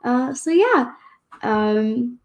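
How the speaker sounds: ordinary talk, nothing marked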